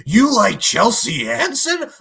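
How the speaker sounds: loud, high, drawn-out incredulous question; mock shock. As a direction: exaggerate the disbelief, imitating his shock. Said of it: "be, like, shocked".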